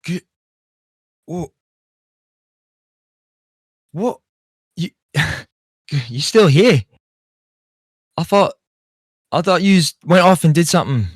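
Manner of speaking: put-on halting, stunned greeting to an old bandmate; shocked. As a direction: trip over your words in shock. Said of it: "shocked look on his face".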